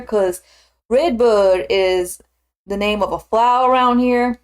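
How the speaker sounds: drawn-out Southern twang, slow on key words; Southern accent. Shown as a demonstration. Streamer explaining a local flower word, Southern accent. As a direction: lean into a leisurely Southern drawl. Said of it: "helps to, to use a Southern accent".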